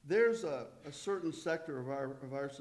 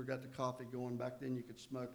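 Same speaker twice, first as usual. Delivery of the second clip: ordinary delivery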